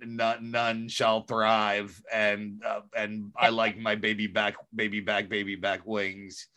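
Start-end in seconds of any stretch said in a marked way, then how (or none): 0.1-2.0 slow, low booming giant; a lightly voiced giant proclaiming. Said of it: "giant voice, uh, shouted". 3.4-6.5 low, soft giant singsong; a hint of a dim giant crooning. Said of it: "giant voice, uh, shouted".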